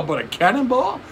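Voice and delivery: high incredulous question; mock disbelief. Retelling Bill Burr questioning the injury, mock disbelief. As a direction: push the question up high, baffled.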